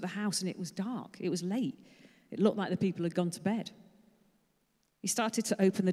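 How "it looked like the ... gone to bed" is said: ordinary talk, nothing marked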